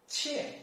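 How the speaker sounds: "qian" starting high and falling sharply; fourth tone. Shown as a demonstration. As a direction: drop "qian" quickly from high. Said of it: "fourth tone".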